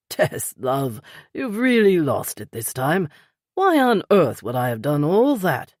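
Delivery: lightly voiced older British man; a gentle hint of the polite Peter; older British gentleman, lightly voiced; Peter's voice. A narrator's reading.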